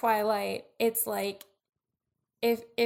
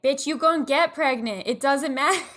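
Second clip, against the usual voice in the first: loud, raised, laughing retort; mock scolding; 4 semitones higher, 8 dB louder than usual. Host talking back to Bella, mock scolding. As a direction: tell off the character in playful exasperation.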